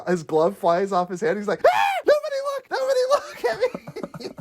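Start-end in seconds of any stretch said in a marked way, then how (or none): 1.7-4.3 much higher, panicked cry; mock panic. Retelling the uncle losing his hand, a high mock panic. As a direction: squeal it like you're mortified.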